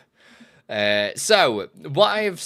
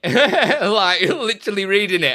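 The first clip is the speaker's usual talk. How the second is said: laughing, high and loud; 8 semitones higher, 7 dB louder than usual. Real, not acted. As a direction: laugh through it. Laughing while telling.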